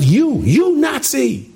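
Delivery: hammed-up high yell; imitated yell. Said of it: "And he yelled".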